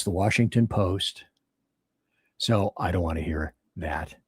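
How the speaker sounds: ordinary delivery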